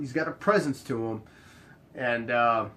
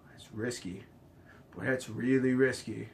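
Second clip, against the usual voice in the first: very soft, low musing; hushed, low retold caution; wary hesitation; 4 semitones lower, 8 dB quieter than usual. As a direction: murmur it doubtfully, as if weighing a bet. Retold wary reply to the deal.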